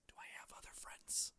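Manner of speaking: soft unvoiced whisper to self; whispered musing. Real, not acted. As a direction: whisper it to yourself. Brooding alone after hang-up.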